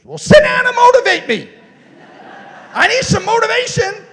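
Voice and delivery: high, loud mock demand; loud retold demand; demanding shout. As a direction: belt it out like a blunt challenge. Neighbor quoted demanding motivation.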